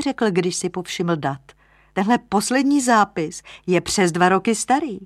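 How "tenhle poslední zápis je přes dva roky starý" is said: raised, louder tone of discovery; surprised lodger. Acted. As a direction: point out a surprising find. (překvapeně, výš a hlasitěji)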